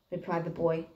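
usual speaking voice

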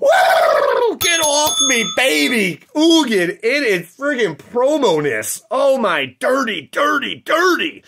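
loud, high, over-the-moon celebration; ecstatic shouting. Real, not acted. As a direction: shout it like you hit the jackpot. Celebrates a rare promo pull.

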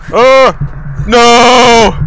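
high, loud cry of dismay; alarmed scream. Real, not acted. Player caught by the creature, alarmed scream. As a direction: scream it out, startled.

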